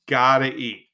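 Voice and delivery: gotta eat in a mock tough-guy voice; gruff put-on voice. Shown as a demonstration. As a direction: growl it like a hungry mobster type. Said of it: "second take, timbre changes".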